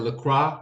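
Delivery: LaCroix said as "luh-KRAH"; unsure pronunciation. Shown as a demonstration. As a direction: try LaCroix with an open KRAH ending.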